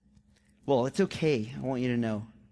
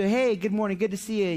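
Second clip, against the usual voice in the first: higher, chirpy acted hello; friendly greeting; 6 semitones higher, 3 dB louder than usual. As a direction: greet someone at church brightly. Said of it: "someone greeted you".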